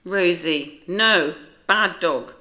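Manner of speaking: loud, slow stern scolding. Acted. Owner scolds growling dog.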